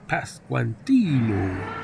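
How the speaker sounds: Brainiac Baseball accent, slowly stretched name; showy, slow Brainiac Baseball accent. Shown as a demonstration. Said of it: "That's called the Brainiac Baseball accent".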